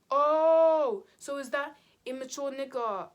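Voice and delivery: sudden high surprised oh. Retold or imitated. Brother learning the whole story, surprised. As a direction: act out the brother's startled question.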